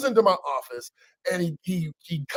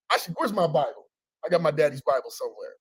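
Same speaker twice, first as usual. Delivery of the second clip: ordinary talk, nothing marked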